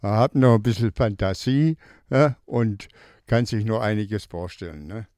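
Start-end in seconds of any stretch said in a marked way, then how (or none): none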